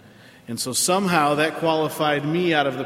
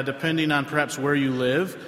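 usual speaking voice

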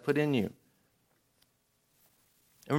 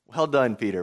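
sarcastic praise; 7 semitones lower than usual. Acted.

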